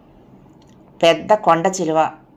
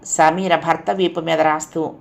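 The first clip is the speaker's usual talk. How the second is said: ordinary delivery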